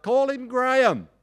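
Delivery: shouted across a noisy pub bar; yelled out. Retold or imitated. Man in the pub shouting a name to dad, loud yell. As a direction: call the name out loud over the crowd. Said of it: "yelled out".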